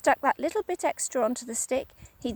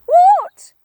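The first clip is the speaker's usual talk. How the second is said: much higher, louder frightened gasp; gasped what; 16 dB louder than usual. Acted. Wife terrified by the shrinks, gasping cry. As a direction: gasp it out in terror. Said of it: "gasped Missus Twit".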